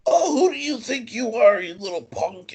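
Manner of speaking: high mock yell, then dropping off; retold wrestler yell. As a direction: imitate a heel wrestler snapping at a kid. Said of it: "yelling at me".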